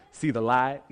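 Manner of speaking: just a little country twang; lightly twanged, testifying line; slight twang. Shown as a demonstration. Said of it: "like, a twang, just a little bit".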